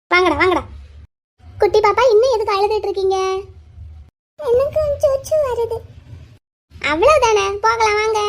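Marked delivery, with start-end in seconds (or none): 4.4-5.8 put-on high, slow childish plea; tearful small child. Crying child asking teacher for the toilet, plaintive little voice. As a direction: plead like an upset toddler.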